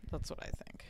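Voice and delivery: whisper. Real, not acted. Quiet aside.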